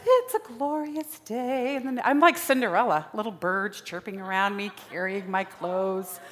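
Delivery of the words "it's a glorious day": mock cheery. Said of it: "I'm telling all my friends".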